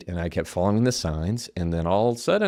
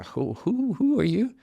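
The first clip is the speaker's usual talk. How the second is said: stammered, much higher, startled question; taken aback; 11 semitones higher, 4 dB quieter, slower than usual. Retold or imitated. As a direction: play being caught off guard by a voice from nowhere. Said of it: "being just taken aback".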